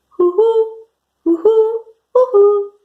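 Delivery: high, hollow double hoots; owl hoots. Shown as a demonstration. Teacher giving kids a clue for the owl, hooting. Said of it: "The owl says hoo-hoo".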